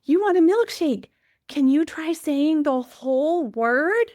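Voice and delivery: put-on sing-song prompting a little girl; high bright coaxing. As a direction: coax her warmly to say the word. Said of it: "this time I said".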